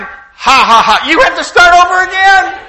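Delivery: loud, high, taunting laugh-quote; a wife's high, loud taunt; mocking gloat. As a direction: gloat back at him with a mocking ha ha. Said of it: "she's going".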